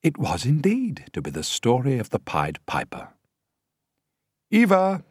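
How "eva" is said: much higher, louder call; shout. A narrator's reading. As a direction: shout across to the wardrobe mistress. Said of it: "the director shouted".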